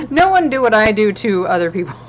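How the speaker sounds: loud laughing words. Real, not acted. Laughing through misquote.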